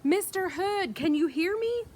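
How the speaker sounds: high, loud, pleading yell, slowing at the end; desperate yelling. Acted. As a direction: scream up the stairs for rescue.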